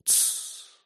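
long unvoiced hush; drawn-out shush. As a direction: hush someone with a finger. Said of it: "протянул он". (протяжное «тссс» шёпотом)

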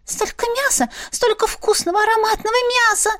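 fast, high excited chatter; rattling off. Acted. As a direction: gush it out fast like a greedy pet. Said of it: "затараторила Ларинь".

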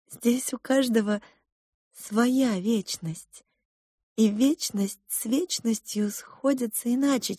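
lightly voiced cook mother. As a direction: explain gently to a child. Cook explains rank gap.

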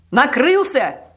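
loud, high, drawn-out exclamation; gleeful repeat. A narrator's reading. Policeman repeating the news of the project's collapse, gleeful shout. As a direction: announce it with a wide grin. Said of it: "повторил полицейский и ухмыльнулся во весь рот".

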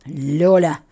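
low, loud growl of a name; angry low-pitched bellow; muffled roar. A narrator's reading. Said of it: "Глухой рык синеглазого графа".

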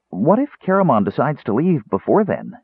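high, anxious kender question; a touch of fear; fearful. A narrator's reading. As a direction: ask it with worry. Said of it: "he asked fearfully".

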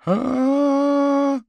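very loud, high-pitched scream; scream from the kitchen. A narrator's reading. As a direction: scream out suddenly at the top of the voice. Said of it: "донеслось внезапно с кухни". (пронзительный крик)

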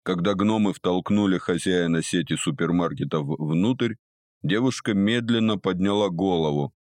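ordinary delivery

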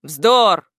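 loud angry burst; flaring up. Acted. Грамотейка dismisses the statue, angry outburst. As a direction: snap out in a temper. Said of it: "вспылил Грамотейка".

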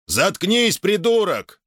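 high-pitched snap of lost patience; exasperated bark. A narrator's reading. Gamaley silencing the robot, exasperated high bark. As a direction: bark it, at the end of your tether. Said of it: "рявкнул, не выдержав, Гамалей".

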